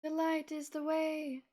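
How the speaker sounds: dramatic proclamation. Pretend. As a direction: proclaim it like a prophecy. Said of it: "repeats line as performance".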